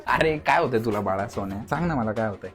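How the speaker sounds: low, soft mock cooing; mock baby talk. Boyfriend coos at sulky girlfriend, low soft baby talk. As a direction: coo at her like a baby.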